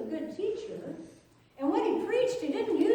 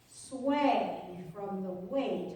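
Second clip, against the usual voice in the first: usual speaking voice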